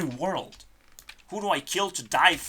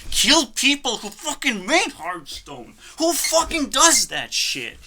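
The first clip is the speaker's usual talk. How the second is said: higher and louder, raging at the game; furious outburst; 8 semitones higher, 6 dB louder than usual. Real, not acted. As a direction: spit it out in real fury. Raging about crashed game.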